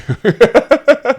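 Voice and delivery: loud, high-pitched burst of laughter. Real, not acted. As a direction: burst out laughing at your own joke. Laughs at his own joke.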